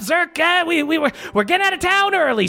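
high, rushed, frantic mother voice; mother hurrying her son. Acted. Mother waking Zerk to flee town, high and hurried. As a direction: urge the boy out fast in a high voice. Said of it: "And she goes".

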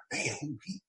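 whispered aside to himself; quiet stunned mutter; hushed inner thought. Retold or imitated. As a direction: breathe it out in disbelief. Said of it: "And I'm like".

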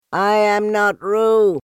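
loud, high, slow protest; loudly. A narrator's reading. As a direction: insist loudly, every word. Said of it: "said Piglet loudly".

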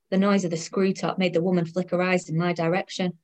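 usual speaking voice

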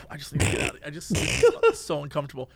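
soft, breathy weary mutter; exasperated murmur. Retold or imitated. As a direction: mutter it to yourself, worn out. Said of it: "And I'm like".

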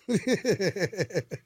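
laughing. Real, not acted. Host joking about being thrown through a table, laughing. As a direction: run of quick chuckles.

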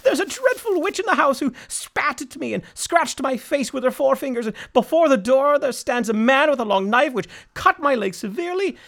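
high, loud panicked robber; terrified robber. Acted. Robber reporting the horrors to his captain, high and panicked. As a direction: gabble it out in high terror.

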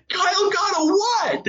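very high, laughing squawk of disbelief; incredulous. Retold or imitated. Dad on a broken-up call from his wife, incredulous. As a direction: blurt it out in shrieky disbelief.

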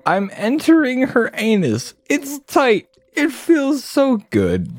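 hammed-up squeaky rodent king; shrill rat king. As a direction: screech at the rat like an outraged king. Rat King's line.

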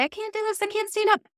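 high mock whine of defeat; whiny defeated voice. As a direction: imitate someone giving up in the cold. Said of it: "curl into ourselves and say".

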